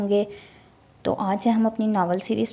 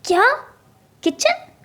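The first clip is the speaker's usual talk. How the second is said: much higher, shocked outcry; loud surprise; slower than usual. A narrator's reading. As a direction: shriek of disbelief. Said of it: "زور سے کہا".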